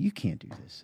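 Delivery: hushed, calm, almost breathless warning; very calmly. Retold or imitated. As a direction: say it quietly and evenly, like a calm caution. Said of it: "That's just very calmly".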